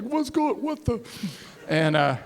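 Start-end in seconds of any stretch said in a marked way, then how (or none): 0.0-1.0 high, baffled what-the; confused Dave. Retold or imitated. Dave waking in the dark, confused and high. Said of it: "Dave's like".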